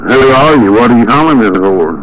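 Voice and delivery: usual speaking voice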